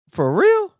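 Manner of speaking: mock disbelief. Real, not acted. Incredulous rhetorical jab at absent fathers.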